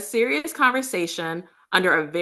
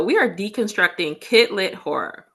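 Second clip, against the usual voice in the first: normal talking voice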